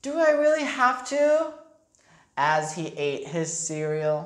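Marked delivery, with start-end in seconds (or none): 0.0-1.5 put-on high, pleading child voice; whiny boy. A narrator's reading. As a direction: beg to get out of it. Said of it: "Arthur asks".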